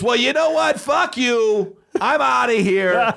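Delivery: retold high-pitched angry outburst; angry walkout. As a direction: act out an offended man storming off. Retold salesman storms out angrily.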